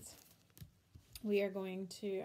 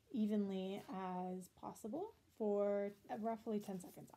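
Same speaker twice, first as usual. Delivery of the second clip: ordinary talk, nothing marked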